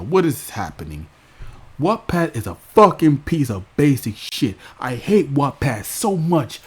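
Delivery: imitating Raven's tantrum. Raven mocked, yelling at her producers about YouTube. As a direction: throw a hammed-up fit at the producers. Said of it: "she will take it out on the producers".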